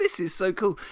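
high-pitched, gleeful exclamation; excited delight. Retold or imitated. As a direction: gush as if just discovering it. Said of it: "can sense the excitement in my voice".

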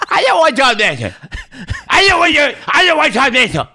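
high mock-grandma refusal; protesting grandma. Grandma refusing visitor over intercom, high comic protest. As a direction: wail it high like a stubborn grandmother. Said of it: "buzzing into my grandma's".

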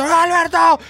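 high-pitched mock fan yell; grito de hincha. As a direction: burst in like an overexcited supporter. Actúa público que interrumpe. (grito exagerado de hincha)

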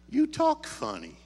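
high-pitched verdict on someone's speech, falling at the end; puzzled accusation. Retold or imitated. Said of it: "And she just looked at me".

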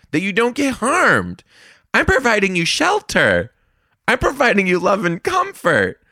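how dare you, hammed up big; dramatic betrayed teacher scolding; offended outrage. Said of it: "the other part of me was like".

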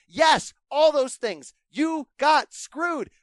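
loud, slowed-down declaration; slow loud emphasis. Real, not acted. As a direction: drag it out loud and heavy.

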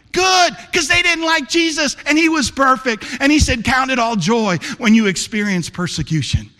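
high, loud, fervent sermon preaching; preaching at full force. Acted. Pastor challenging his church family, preaching at full force. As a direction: drive it home to the congregation.

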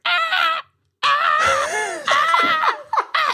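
loud repeated screams; alarm shouts. Retold or imitated. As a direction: yell like a panicking alarm. Phone alarm counting down.

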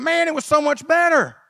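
high, loud mocking whine of nostalgia; hammed-up crowd crying for Egypt; mock whining Israelites. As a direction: lament slavery days like spoiled complainers. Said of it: "They're crying out, going".

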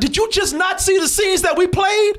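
real incredulous shout; exasperated yell. Real, not acted. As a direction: call out the dumb question. Exasperated rant at reviewer.

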